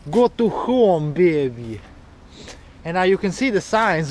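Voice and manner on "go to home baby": slow Russian accent, stretched words. Shown as a demonstration. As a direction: read it in a thick Russian accent. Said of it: "read this in a Russian accent".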